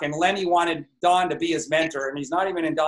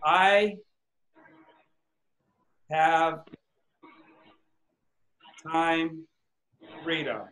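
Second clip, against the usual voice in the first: each word spaced by long pauses, fading softer; slow dictation; 3 dB quieter, slower than usual. Shown as a demonstration. Coach writes the affirmation word by word, slowly. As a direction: spell it out slowly as you write. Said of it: "I better write that".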